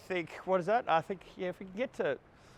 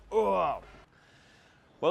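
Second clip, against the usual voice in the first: usual speaking voice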